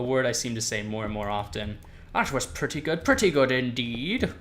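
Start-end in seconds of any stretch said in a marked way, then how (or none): 2.1-4.3 posh British. Acted. Gamer mocking his own habit of saying indeed, posh British accent. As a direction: play at being a posh Brit, lilting up on "indeed". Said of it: "I became posh and British".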